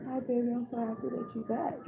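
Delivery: put-on high-pitched, tender cooing to a child; retold tearful relief. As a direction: imitate greeting your child through happy tears. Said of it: "crying and".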